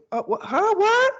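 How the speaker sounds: put-on high-pitched kid answering, rising to loud; imitated daughter's reply. Daughter answering when dad calls her, high imitated yelp. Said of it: "she goes".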